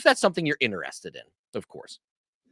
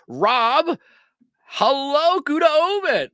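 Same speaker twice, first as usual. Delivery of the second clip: loud, higher host welcome; louder, higher show-host hello; excited greeting; 11 semitones higher, 6 dB louder, much slower than usual. Real, not acted. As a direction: greet the guest with big energy.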